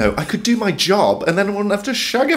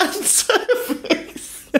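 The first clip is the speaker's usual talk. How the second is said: laughing; 3 dB quieter, much slower than usual. Real, not acted. Laughing repeat of narration.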